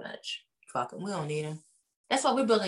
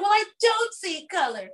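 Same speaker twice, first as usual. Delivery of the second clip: much higher, prissy mocking protest; mock defensive Sutton; 12 semitones higher than usual. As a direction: say it like an innocent denial. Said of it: "She's like".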